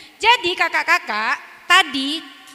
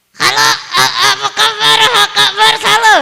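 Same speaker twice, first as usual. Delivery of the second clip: high, loud puppet voice; puppet greeting; 6 semitones higher, 11 dB louder than usual. Shown as a demonstration. Ventriloquist showing the puppet greeting, high and loud. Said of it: "harusnya bukaan mulutnya itu".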